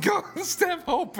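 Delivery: high mock call. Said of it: "and I'm like".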